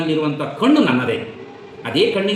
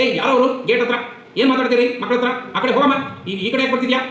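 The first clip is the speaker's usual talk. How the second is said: higher, harsh telling-off of a stranger; angry scolding; 9 semitones higher than usual. Retold or imitated. Son at the window scolding the old woman at the gate, angry and raised. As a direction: shoo her away from the gate, scolding. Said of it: "ಜೋರ್ ಮಾಡ್ಬಿಡ್ತಾನೆ".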